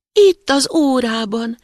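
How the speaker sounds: put-on slow, high little kid-goat squeak. A narrator's reading. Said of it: "cincogta a gida". (magas, elnyújtott cincogás)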